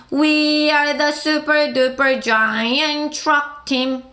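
very slow, word by word; teacher slowly reading a song line; slow lyric reading. Shown as a demonstration. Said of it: "천천히 시작".

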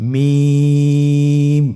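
মিম with the vowel held very long and thin; slow held recitation of মিম; long drawn vowel. Shown as a demonstration. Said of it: "পাতলা হবে এবং তিন আলিফ টেনে উচ্চারণ করতে হবে".

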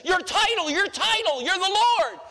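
mock fervent shout; emphatic mock cry. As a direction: cry it out high like a hollow acclamation. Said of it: "what they are saying is".

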